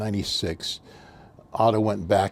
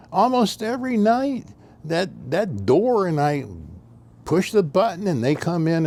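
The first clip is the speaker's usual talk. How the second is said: put-on confused old man; 7 semitones higher than usual. Old man complaining, voiced.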